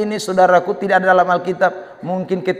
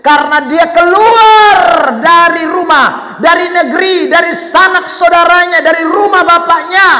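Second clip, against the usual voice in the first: high, raised pulpit voice; fervent preaching; 9 semitones higher, 7 dB louder than usual. Real, not acted. Preacher urges congregation out of comfort zone, fervent preaching. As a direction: build to loud, stretched key words.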